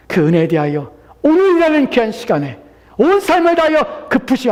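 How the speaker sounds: high, loud exhortation; full-force preaching. Acted. Preacher urging listeners to come to Christ, full-force preaching. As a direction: proclaim it urgently at the top of the voice.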